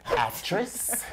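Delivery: usual speaking voice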